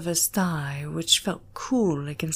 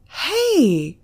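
higher, louder startled cry; shocked and annoyed; 8 semitones higher, 5 dB louder than usual. Acted. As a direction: yelp it as you nearly fall. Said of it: "genuinely shocked and annoyed".

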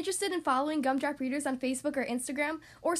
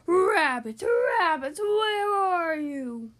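howled call; 3 semitones higher, 8 dB louder, much slower than usual. Acted. Wolf Boy calling for rabbits.

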